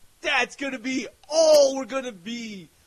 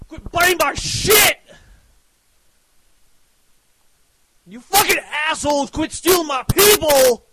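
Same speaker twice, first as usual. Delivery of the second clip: higher and louder, yelling at the copycats; angry shout; 4 semitones higher, 6 dB louder than usual. Real, not acted. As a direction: scream it at the thieves, fed up.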